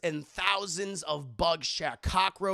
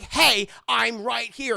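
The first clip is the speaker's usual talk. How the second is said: much louder, slow, higher call; 5 semitones higher, 10 dB louder, slower than usual. Acted. Willie loudly calling Indy away from the statue. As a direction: call it out to get noticed.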